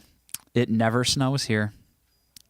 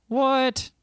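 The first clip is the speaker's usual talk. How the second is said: surprised; 14 semitones higher than usual. Real, not acted. Shocked at chat's message.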